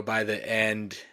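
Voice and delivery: ordinary delivery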